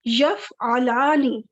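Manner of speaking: یفعلانی said very slowly, every syllable drawn out, the long آنی ending held; drawn-out demo word. Shown as a demonstration. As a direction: stretch out یفعلانی so the آنی sound is clear. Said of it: "دیکھیں یہ کون سی sound ہے؟".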